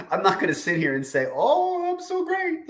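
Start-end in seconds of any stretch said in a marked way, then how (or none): 1.3-2.7 high-pitched mock boast, far above normal voice; mock self-praise. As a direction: draw it out in a put-on high voice.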